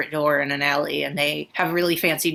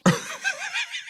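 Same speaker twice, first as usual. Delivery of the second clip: squeaky high laugh; high giggle; 5 dB quieter than usual. Real, not acted. Laughs about cotton candy.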